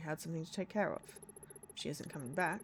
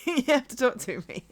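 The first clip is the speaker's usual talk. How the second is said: laughing; 5 semitones higher, 11 dB louder than usual. Real, not acted. Laughs about yawning.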